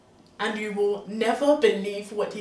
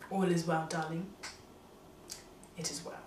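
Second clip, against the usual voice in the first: gentle reassurance; 5 semitones lower, 11 dB quieter than usual. Retold or imitated. Husband reassures wife quietly.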